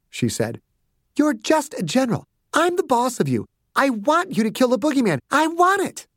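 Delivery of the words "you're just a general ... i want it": put-on very high, demanding little girl; a small tyrant insisting on getting her way; bossy child. Voices child Chelsea.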